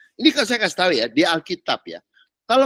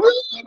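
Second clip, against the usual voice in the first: high-pitched "wah" cry; sudden high mock shout; mock yell. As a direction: imitate a startled disciple yelling out. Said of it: "teriak gitu".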